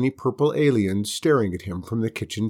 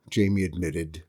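ordinary delivery, nothing marked

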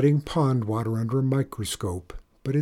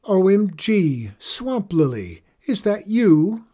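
high, slow, mocking disbelief; sarcastic big sister teasing; mock surprise; 8 semitones higher, 6 dB louder, slower than usual. A narrator's reading. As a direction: pretend not to recognise her. Sister mocks with fake surprise.

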